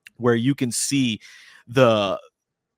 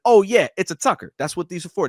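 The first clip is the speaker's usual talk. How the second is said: casual cop explanation; 7 semitones higher, 5 dB louder than usual. Retold or imitated. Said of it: "the police officer was like".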